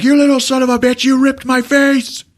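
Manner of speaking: high, loud rage, fast then dragging; wounded thug raging; furious yell. Retold or imitated. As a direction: scream it at the boy. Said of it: "Leon's like".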